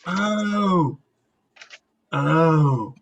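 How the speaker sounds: drawn-out, condescending "oh"; loaded, patronizing reaction. Acted. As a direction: talk down to them with one long syllable. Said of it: "it gets more condescending every time she says it".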